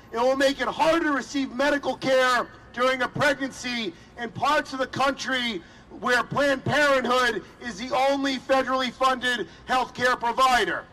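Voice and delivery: ordinary talk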